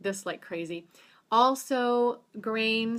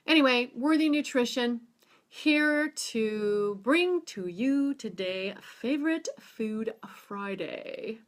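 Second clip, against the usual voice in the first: show intro announcement; 4 semitones higher, slower than usual. Acted. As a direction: announce the show title like a host. Restarts show intro announcer style.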